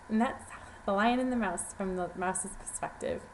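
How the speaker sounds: plain reading voice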